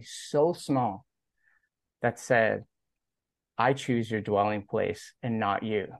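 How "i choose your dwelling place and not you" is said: ordinary delivery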